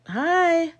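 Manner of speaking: a high, awkward little greeting; mock sheepish hello to strangers. Imitating herself greeting the students, awkward and high. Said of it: "and you're just like".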